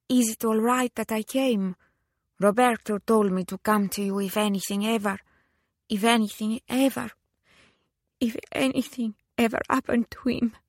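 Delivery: put-on high, faltering Italian-accented plea; grieving lady, voice lifted, breaking off and restarting; Italian widow, tearful. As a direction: plead haltingly in an Italian accent. Tearful widow asks sister-in-law for refuge.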